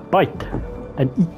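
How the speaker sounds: ordinary delivery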